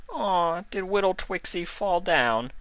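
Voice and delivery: put-on high, sing-song baby voice; mocking baby talk. A narrator's reading. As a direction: coo at her like a toddler.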